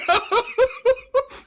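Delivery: high squeaky giggle; squeaky gleeful gremlin giggle. Real, not acted. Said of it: "the gremlin voice gets me every time".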